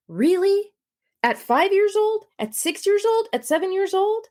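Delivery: indignant disbelief; incredulous. Real, not acted. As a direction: rise in outrage at each age. Outraged rhetorical questions.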